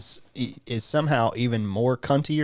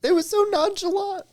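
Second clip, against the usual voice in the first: very high, squeaking through real laughter; helpless laughter; 4 dB louder than usual. Real, not acted. Co-host explaining what was so funny, laughing helplessly. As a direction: still cracking up while explaining. Still broken by friend's phrase.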